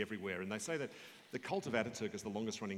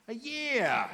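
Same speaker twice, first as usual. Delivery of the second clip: high, loud, drawn-out cry; imitated burst of gleeful one-upping; exuberant outbid; 12 semitones higher, 11 dB louder than usual. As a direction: top the offer with a soaring shout. Tops the bid in retold exchange.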